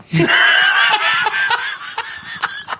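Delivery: shrieking burst of laughter. Real, not acted. Cracking up at own joke.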